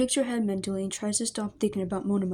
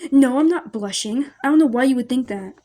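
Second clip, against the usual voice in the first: flustered denial; 3 semitones higher, 10 dB louder than usual. Acted. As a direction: deny it loudly, caught blushing. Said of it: "turns beet red and looks away".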